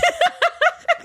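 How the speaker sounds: high burst of laughter; high-pitched, helpless laughing; real laugh. Real, not acted. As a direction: crack up at a friend's remark. Cracks up at listener's remark.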